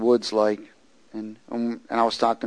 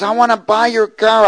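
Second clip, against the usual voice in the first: imitating a loud, high holler; retold yell; 11 semitones higher, 8 dB louder than usual. Big man yelling his offer to the preacher, imitated yell. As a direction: shout it as the insistent stranger. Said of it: "he starts yelling to me".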